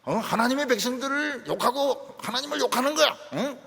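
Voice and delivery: loud, high angry declaration; angry outburst. Acted. Young David angrily vows to fight the giant, loud and high. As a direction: bristle and demand to fight. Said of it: "더 화를 내면서".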